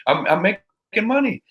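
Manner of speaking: high-pitched, defensive retort; acted protest. Younger self arguing back to the mentor who yelled, high-pitched protest.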